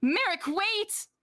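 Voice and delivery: high-pitched, urgent call; shout. Acted. Karina calls Merrick to wait, a high shout. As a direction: cry it out high, calling someone to wait. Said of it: "Karina shout".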